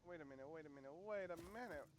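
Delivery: ordinary talk, nothing marked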